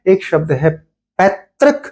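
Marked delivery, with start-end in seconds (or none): 1.2-1.8 पैतृक set apart, pitched up on its own; isolated, raised पैतृक with a rising end; careful pronunciation. Shown as a demonstration. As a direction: lift पैतृक high and say it clearly alone. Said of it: "इसे ठीक रूप से लिखा जाता है पैतृक".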